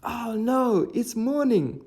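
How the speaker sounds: high, alarmed cry; panicked demons. Acted. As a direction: shriek in sudden panic. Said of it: "the demons cried".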